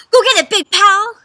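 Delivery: high, loud, eager shout; thrilled call to fetch. A narrator's reading. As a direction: call out to your pal excitedly. Dog excitedly calls fetch.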